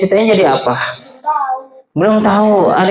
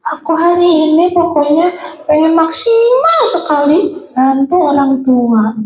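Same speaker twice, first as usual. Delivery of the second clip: put-on much higher, small child's voice thanking God; grateful child's prayer; 10 semitones higher than usual. Teacher playing a thankful child for young pupils, high and sweet. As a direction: sound like a little child full of wonder and thanks.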